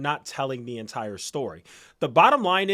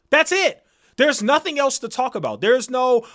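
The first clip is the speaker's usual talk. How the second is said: angry outburst; 7 semitones higher, 4 dB louder than usual. Real, not acted. Rant against deflecting blame.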